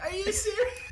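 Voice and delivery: high, laughing "are you serious"; laughing disbelief. Retold or imitated. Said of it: "And I was just like".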